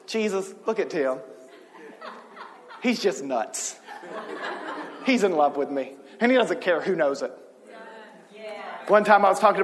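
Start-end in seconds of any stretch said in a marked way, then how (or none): 0.1-7.3 low, soft amused aside as God; lightly voiced chuckling God; quietly tickled, imitating the Lord. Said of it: "the Lord laughs and be like".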